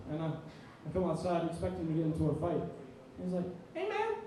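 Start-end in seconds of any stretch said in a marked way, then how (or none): 3.7-4.2 much higher, sing-song friendly greeting; light and chummy, no threat at all; friendly hey. Retold or imitated. Former rival greets him, high and friendly.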